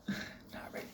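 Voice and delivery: breathy whisper undercutting himself; whispered aside. Real, not acted. Vlogger undercutting his own tree speech, whispered. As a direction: whisper it as a quick self-deflating joke.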